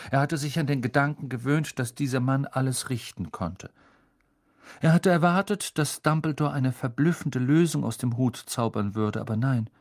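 ordinary delivery

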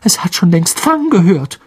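high, strained, alarmed tone; unnaturally high voice. A narrator's reading. Ron to Harry about the barking dog, unnaturally high voice. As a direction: pitch it up in panic. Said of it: "mit unnatürlich hoher Stimme".

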